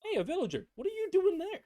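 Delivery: sudden high-pitched surprise; startled, calling out; surprised high call. Real, not acted. Surprised at villager in game.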